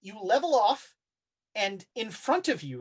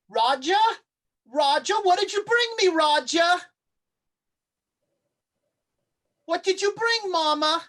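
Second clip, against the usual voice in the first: high, loud, coaxing creature calling a name; eerie Mama voice; 10 semitones higher, 5 dB louder than usual. Acted. GM as the monster calling to Raja, high and coaxing. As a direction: lure him in like a hungry mother.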